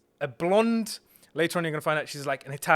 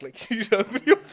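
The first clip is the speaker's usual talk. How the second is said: breaking into laughter mid-question, higher and louder; laughing; 6 semitones higher, 5 dB louder, faster than usual. Real, not acted. Storyteller recalling his awkward request to open the bag, laughing. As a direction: crack up while retelling the question.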